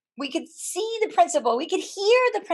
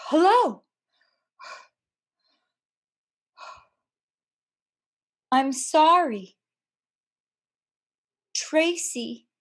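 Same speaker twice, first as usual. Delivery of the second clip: reluctant principal; 3 semitones higher, slower than usual. Retold or imitated. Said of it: "and he says".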